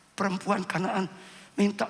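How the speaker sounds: ordinary talk, nothing marked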